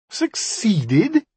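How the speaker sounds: high, scornful echo of the word; incredulous cry. A narrator's reading. Magician mocks the djinni's claim of success, incredulous cry. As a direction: throw it back in high disbelief. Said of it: "he cried".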